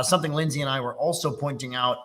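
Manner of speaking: ordinary talk, nothing marked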